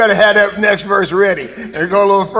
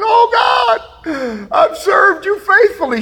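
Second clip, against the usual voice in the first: much higher, hammed-up wail at the altar; mock weeping worshipper; 10 semitones higher, slower than usual. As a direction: parody a whining worshipper calling out. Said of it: "at the altar crying and weeping".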